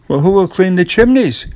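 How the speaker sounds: coaxing Jack. Acted. Jack persuades woman.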